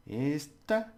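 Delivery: "Esta" with a high, held "Es"; stress on first syllable. Shown as a demonstration. Teacher showing how this sounds, stress on first syllable. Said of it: "You just hold it a bit".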